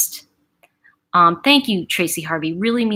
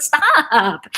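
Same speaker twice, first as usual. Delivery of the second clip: higher mock protest; exasperated daughter; 6 semitones higher, 3 dB louder than usual. Daughter answering her dad back, mock exasperated. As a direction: echo the outburst, a little milder. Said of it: "I'd be like".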